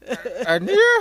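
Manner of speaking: high, loud stunned question; disbelieving gasp of a question; shocked cry. Acted. Shocked at news of death.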